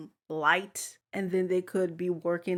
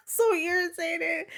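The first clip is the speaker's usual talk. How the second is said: laughing; 12 semitones higher than usual. Real, not acted. Laughing while complaining.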